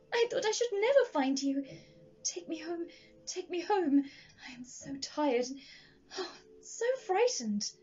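high-pitched pleading, nearly sobbing; despairing plea. A narrator's reading. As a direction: beg to be taken home, voice rising in distress. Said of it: "died away with a wailing sob".

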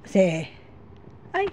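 ordinary talk

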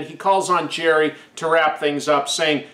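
usual speaking voice

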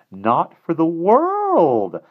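hammering the promise home; emphatic insistence. A narrator's reading. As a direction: drag out "Not for the world" with force. Little man insists earnestly.

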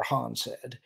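plain reading voice

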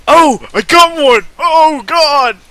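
loud, high excited shout over a catch. Acted. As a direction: yell with joy at the bite. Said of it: "Wolf exclaimed excitedly".

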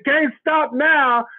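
high, slow and emphatic, each word driven home; rousing preacher call. Real, not acted. Host urging the group to keep pedaling, high slow rousing call. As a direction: preach it like a revival chant to keep them going. Motivational pep talk to group.

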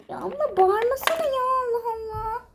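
crying. Acted. Younger sister crying at her big sister to stop yelling. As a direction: sob out the complaint.

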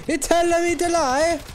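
high, loud, hammed-up Italian accent; mock Italian. Joking about Italians' hand gestures, hammed-up Italian accent. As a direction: belt it out as an exaggerated Italian caricature. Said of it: "The, the one that's like".

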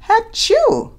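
high, loud playful achoo; mock sneeze. Reading to children, a big hammed-up sneeze. Reader acts out dinosaur sneeze.

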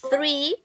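THREE with a long puff of air on TH; THREE with tongue tip between the teeth. Shown as a demonstration. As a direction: blow through TH at the start of THREE. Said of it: "estoy hablando del número tres".

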